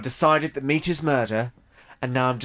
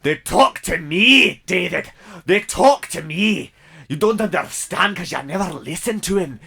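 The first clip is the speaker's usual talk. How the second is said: put-on Scottish accent; 2 semitones higher than usual. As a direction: argue hard in a Scottish accent.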